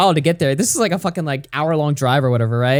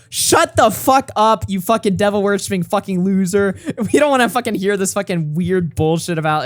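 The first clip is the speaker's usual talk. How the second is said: retold screaming; 5 semitones higher than usual. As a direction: shout him down like a mob. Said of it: "we're all just screaming".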